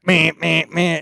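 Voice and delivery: high, short mock bumping noises; imitated nose bumps. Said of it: "my nose is gonna be like".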